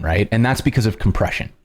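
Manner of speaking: ordinary talk, nothing marked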